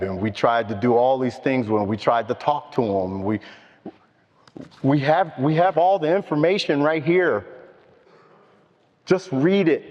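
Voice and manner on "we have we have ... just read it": higher-voiced imploring, as if retelling a desperate appeal; begging. Parent urging addicted children to read the information, begging. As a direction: imitate urgent pleading, pitched high. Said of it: "We're begging 'em".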